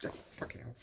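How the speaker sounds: muttered frustrated curse under the breath. Real, not acted. Grumbling at his own forgetfulness, cursing under his breath. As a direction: swear quietly at yourself for losing the word. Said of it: "frustrated aside".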